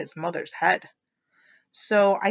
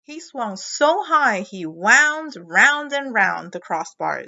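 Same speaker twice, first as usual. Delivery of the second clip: slow, louder build; dramatic slow read; 7 dB louder, slower than usual. A narrator's reading. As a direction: stretch it out as he swings.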